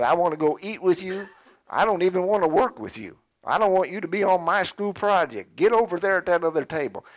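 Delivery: mock rejection. Preacher acting out someone refusing to share a school project, mock rejection. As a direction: push them away loudly, in hammed-up dislike. Acted rejection of a disliked person.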